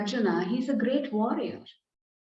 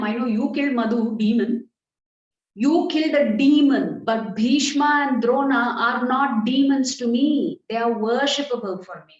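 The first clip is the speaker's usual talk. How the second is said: louder, insistent objection as Arjuna; impassioned protest; 2 semitones higher, 6 dB louder than usual. Acted. Arjuna objects to killing his teacher and grandfather, impassioned protest. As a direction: plead the case forcefully, voice raised.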